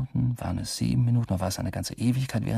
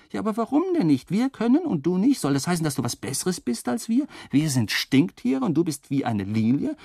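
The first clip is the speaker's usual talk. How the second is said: much higher, fast, indignant accusation; indignant roommates; 7 semitones higher than usual. Retold or imitated. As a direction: hurl the question like offended roommates.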